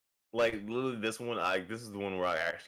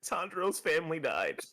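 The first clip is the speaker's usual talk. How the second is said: higher, mock-tearful wail; imitated crying mom; 6 semitones higher than usual. Mom crying over a show to her kid, mock tearful. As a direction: play a crying mom, hammed-up. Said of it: "she's just sitting there crying".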